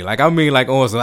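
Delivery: ordinary delivery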